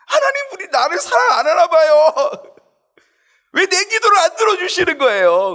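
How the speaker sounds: very high, loud whining plea; Delilah-like nagging prayer. Acted. Believer whining to God like Delilah, very high and loud. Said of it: "우리가 드릴라가 되는 거예요".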